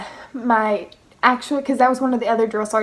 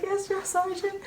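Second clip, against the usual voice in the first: high, soft mock whimper; imitated tearful reply; 9 semitones higher, 8 dB quieter than usual. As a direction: squeak out obedience as if crying. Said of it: "So I start crying in formation".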